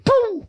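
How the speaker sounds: high, sudden boom; high-pitched imitation of a switch kicking in; mimicked button sound. Shown as a demonstration. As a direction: pop out a high sound effect.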